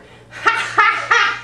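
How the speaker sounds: high loud bird cackle; rook cackle. Acted. Rook bursts out cackling at the young man.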